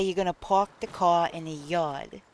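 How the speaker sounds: "park the car in a yard" with the r sounded; New York accent. Shown as a demonstration. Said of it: "If you're from New York".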